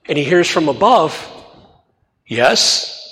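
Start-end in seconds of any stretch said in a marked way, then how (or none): 2.3-3.0 a hint of God's voice. God answers from above.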